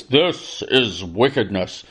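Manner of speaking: deep, slow, sonorous newsreel voice; old-timey documentary announcer. Retold or imitated. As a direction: boom it like a vintage documentary narrator. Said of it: "documentary announcers with their sonorous voice".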